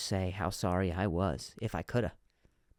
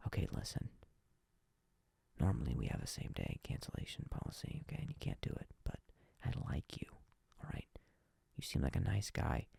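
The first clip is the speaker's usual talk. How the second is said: secretive whisper, barely voiced; hushed whisper; 8 semitones lower, 11 dB quieter than usual. The client whispering a secret deal over the phone. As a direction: lean in and whisper like a conspirator. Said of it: "he got real quiet".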